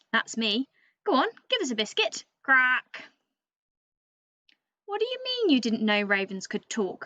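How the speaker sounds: a chatty, know-it-all bird telling the tale with lively swings in pitch; cheeky raven storyteller. Acted. Teacher reading to Year Three as the boastful raven narrator, lively and cheeky. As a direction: read it as a show-off raven talking straight to the kids.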